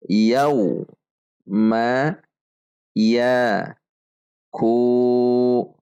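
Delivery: each syllable set apart with pauses; drill syllables. Shown as a demonstration. Teacher drills each syllable for students. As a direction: clip each sound separately, like a drill. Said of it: "Mari perhatikan panjang dan pendek bacaan".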